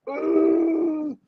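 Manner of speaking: high winded groan. Retold or imitated. Wind knocked out by a fall.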